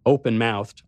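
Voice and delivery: plain reading voice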